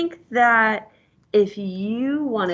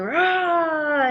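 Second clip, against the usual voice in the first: mock wolf roar; 7 semitones higher, 4 dB louder than usual. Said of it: "he was going".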